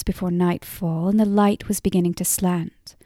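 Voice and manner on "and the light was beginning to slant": ordinary delivery, nothing marked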